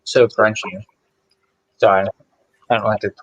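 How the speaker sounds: chewing while talking; mouth full. Real, not acted. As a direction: talk through a mouthful of food. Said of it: "talk with my mouth open here".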